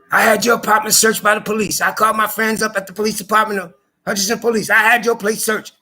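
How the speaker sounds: imitating landlady. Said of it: "it comes out of her mouth is these exact words".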